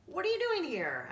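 high, loud demand; pirate queen demanding. Acted. Pirate queen questions the stranger, high loud demand. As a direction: bark the question at him. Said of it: "asked the woman".

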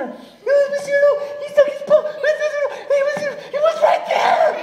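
very high, fast, breathless squeak; frightened sheep. Acted. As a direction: babble fast in a tiny high voice. Scared sheep reports again.

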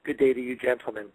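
stiff, formal monotone. Pretend. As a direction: deliver it deadpan and proper. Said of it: "I'm not speaking in a very monotone voice".

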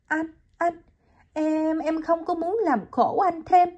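put-on high, halting young woman's voice, stammering; young woman pleading.

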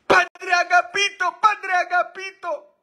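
very high, loud falsetto yell for the priest; hammed-up screaming maid; frantic maid yelling. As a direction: shriek his name in a panic. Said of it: "gritando a todo dar".

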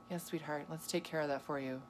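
soft, hushed, sugary sweetheart reply; mock sweet reply. Wife humoring her husband about ice cream, softly and sweetly. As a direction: coo it softly to him, half teasing.

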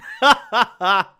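short, high, loud laugh; real loud laughter. Real, not acted. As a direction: crack up loudly at a blunt insult. Laughing at a blunt comment.

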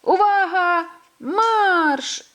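loud, high starting call; a mole shouting the start for the sled race; shouting command. A narrator's reading.

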